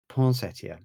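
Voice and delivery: Poinsettia said the French way, nasal vowels; Poinsettia with a playful French accent; French-style Poinsettia. Shown as a demonstration. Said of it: "I say it with a French accent".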